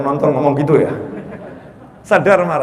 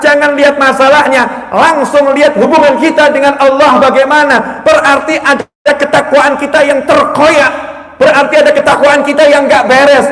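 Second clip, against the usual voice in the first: louder, higher full-force preaching; ceramah berapi-api; 9 semitones higher, 8 dB louder than usual. Acted. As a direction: drive each point home to the congregation. (khotbah lantang berapi-api)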